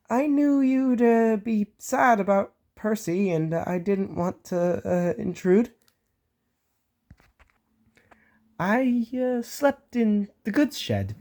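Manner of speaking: high, slow, hemming and hawing; hesitant excuse. Acted. Thomas explaining where he'd been, hesitant. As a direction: stall with embarrassed ums.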